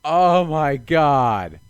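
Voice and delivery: a loud, drawn-out, stunned oh my God; stunned shout. Real, not acted. Player spotting the answer he missed, a loud stunned cry. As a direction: blurt it out slowly, floored by the answer. Suddenly sees the puzzle answer.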